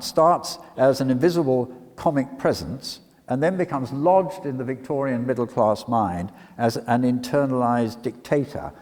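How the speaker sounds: usual speaking voice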